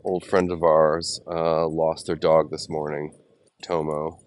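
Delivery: usual speaking voice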